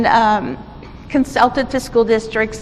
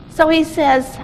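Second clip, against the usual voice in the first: usual speaking voice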